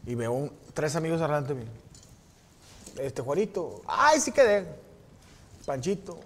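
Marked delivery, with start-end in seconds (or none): 3.9-4.7 high, loud, prissy "ay, sí quedé"; lightly mocking singsong; mock smug classmate. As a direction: imitate a smug kid who passed.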